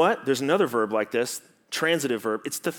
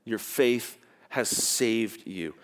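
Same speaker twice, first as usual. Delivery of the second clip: slow, tender reading; 4 semitones lower, 5 dB quieter, slower than usual. Shown as a demonstration. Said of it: "It literally means this".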